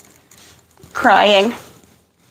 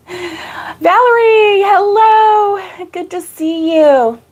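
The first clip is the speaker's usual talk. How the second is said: excited greeting; 8 semitones higher, slower than usual. Real, not acted. Greeting live chat.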